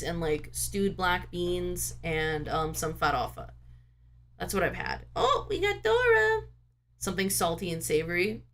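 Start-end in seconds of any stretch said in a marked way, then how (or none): 5.1-6.4 much higher, sing-song greeting to a pet. Real, not acted. Streamer greets her cat Dora in high baby talk. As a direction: coo like the cat just walked in.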